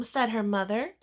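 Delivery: ordinary delivery, nothing marked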